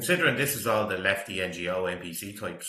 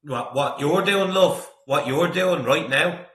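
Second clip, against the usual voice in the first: loud, disbelieving, slowed down; incredulous call-out; 6 semitones higher, 9 dB louder than usual. Real, not acted. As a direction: demand an answer from the screen. Shouting at woman in clip.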